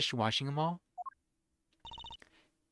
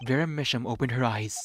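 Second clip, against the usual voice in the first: ordinary delivery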